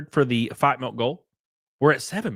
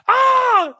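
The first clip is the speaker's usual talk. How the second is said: loud, very high yelp; sudden high-pitched shriek; high scream; 7 dB louder than usual. Acted. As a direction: let out a piercing screech. Startled screech at the video.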